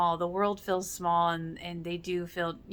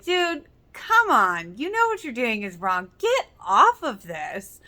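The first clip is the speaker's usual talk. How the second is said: calling someone out; 4 semitones higher, 8 dB louder than usual. Retold or imitated. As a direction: scold a friend to drop it, dragging each word out.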